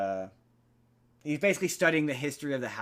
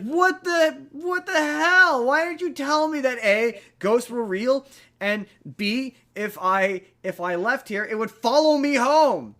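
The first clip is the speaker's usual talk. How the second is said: much higher, much louder, slow outraged shout; furious yell; 11 semitones higher, 8 dB louder, slower than usual. Retold or imitated. Haunted man yelling at the homeowner for not warning him, outraged. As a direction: blow up at her in mock fury.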